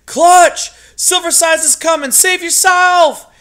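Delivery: desperate high shout; crying out. A narrator's reading. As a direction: scream the warning with all he has left. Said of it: "and cry out".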